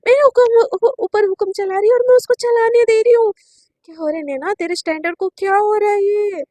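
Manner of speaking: loud, high indignant cry; indignant outburst. A narrator's reading. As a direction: rail at yourself in disbelief. Indignant self-talk. (अविश्वास में ऊँची, तीखी आवाज़)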